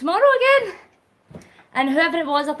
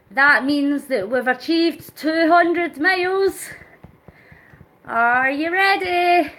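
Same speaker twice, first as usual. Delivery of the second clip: excited build-up; 3 semitones higher, 6 dB louder than usual. Real, not acted. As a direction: hype up the countdown. Countdown to 200 miles.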